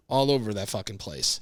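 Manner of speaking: usual speaking voice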